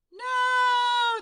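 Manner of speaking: high loud no; crowd yell. Acted. Crowd answering Pip, high loud shout. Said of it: "the crowd yelled".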